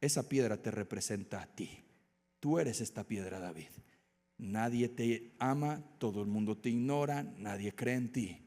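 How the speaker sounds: soft, low, reassuring; tender voice of God. Acted. God speaking tenderly to David, soft. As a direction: speak it gently like a loving father. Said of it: "Dios le dijo a David".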